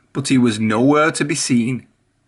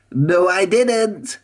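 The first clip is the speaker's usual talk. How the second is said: higher, slow and cheerful; happy whistling Whiff; 6 semitones higher, much slower than usual. A narrator's reading. Whiff corrects Emily, happily and slowly. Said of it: "whistled Whiff happily".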